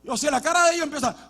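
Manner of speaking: normal talking voice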